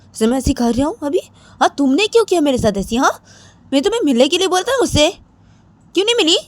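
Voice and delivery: high, rising complaint; hurt, insistent questioning; indignant demand. Acted. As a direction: press for an answer, pitch climbing. Demanding why they did not meet.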